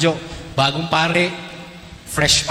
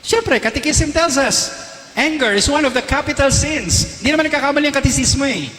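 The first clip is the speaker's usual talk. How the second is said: emphatic preaching; 10 semitones higher than usual. Real, not acted.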